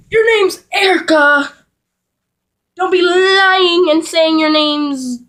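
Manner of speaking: high, shouted scolding; calling out a lie. Retold or imitated. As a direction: scold your sister, stretching out the words.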